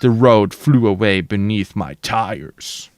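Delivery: usual speaking voice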